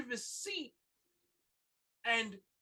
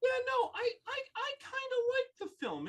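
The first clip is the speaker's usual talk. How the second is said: high sheepish voice; 4 dB louder than usual. Retold or imitated. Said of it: "voicing Patton Oswalt".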